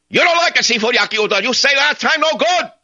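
high, broken-English imitation of the boss; mock restaurant boss. Big boss on phone.

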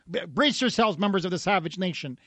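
ordinary delivery

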